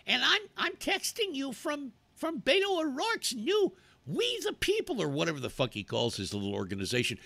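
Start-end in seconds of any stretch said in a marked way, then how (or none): 0.1-5.0 squeaky mock campaign-texter voice; [name]. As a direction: parody a canvasser in high falsetto. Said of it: "wanting to know".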